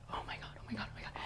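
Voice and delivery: rapid hushed panic under the breath; mortified whisper. Retold or imitated. Woman retells panic as he refuses her, mortified whisper. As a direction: whisper it over and over, appalled.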